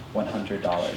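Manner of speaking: very soft, low awe; quiet, stunned discovery; hushed reveal. Retold or imitated. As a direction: read it out almost under your breath. Suspense at opening the card.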